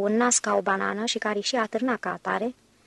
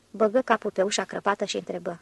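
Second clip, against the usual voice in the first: ordinary delivery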